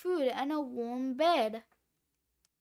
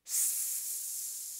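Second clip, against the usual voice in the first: long, soft hiss of escaping gas; sustained voiceless sss; imitated gas hiss; 15 dB quieter than usual. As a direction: hiss like a helium tank. Helium gas hissing, voiced effect.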